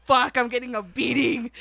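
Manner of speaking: loud, alarmed inner cry; comic panicked realisation; mock dread. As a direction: blurt it like a kid who knows trouble is coming. Child dreading mother's punishment, retold.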